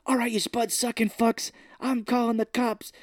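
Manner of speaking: lightly put-on Irish brogue; mock Irish accent. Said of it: "He, like, does an Irish accent to him".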